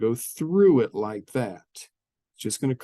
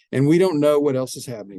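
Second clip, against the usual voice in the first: louder, fast emphatic point; emphatic warning; 4 semitones higher, 7 dB louder, faster than usual. Shown as a demonstration. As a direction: push it out fast to make the point. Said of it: "teacher stressing the unknown behaviour".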